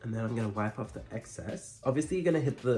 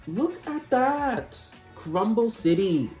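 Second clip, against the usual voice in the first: campy drag queen; 11 semitones higher, 4 dB louder, slower than usual. Real, not acted. Mock dramatic reaction to crumbling.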